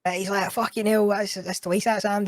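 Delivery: imitated groan of annoyance; retold exasperation. Retelling the car owner's exasperated reaction.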